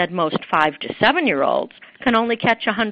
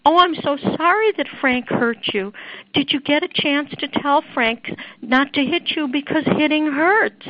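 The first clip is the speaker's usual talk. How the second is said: higher, syrupy teacher voice, slowing on the end; mock sugary preschool teacher; sweet teacher; 8 semitones higher than usual. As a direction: coo it gently to the upset child. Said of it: "teacher would sweetly say".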